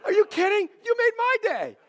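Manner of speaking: elated retold reply with the stress on "my"; laughing delight. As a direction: toss "my" back at him with a laugh. Joyful reply to caller.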